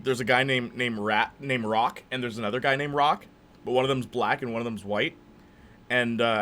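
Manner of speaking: usual speaking voice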